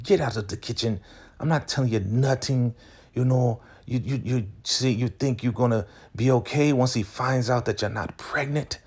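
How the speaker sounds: high-pitched mock telling-off; retold scolding. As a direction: imitate a cook shooing out a rival. Acts out the kitchen quarrel.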